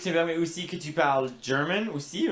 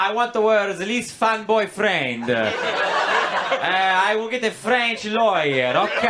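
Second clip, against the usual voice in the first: high, slow, pouty demand for a French lawyer; mock girlfriend; 5 semitones higher, 5 dB louder, slower than usual. Comic voicing a girlfriend demanding a lawyer, high and slow. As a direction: whine like a spoiled girlfriend. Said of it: "Can you be like".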